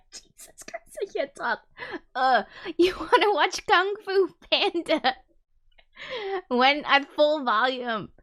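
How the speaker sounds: barely holding it together through laughter; laughing fit. Real, not acted. Streamer recovers from a slip, laughing.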